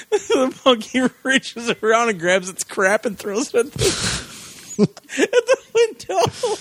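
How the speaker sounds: high, slowed down by laughter; real helpless laughing. Real, not acted. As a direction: squeeze the punchline out between laughs. Laughing through the punchline.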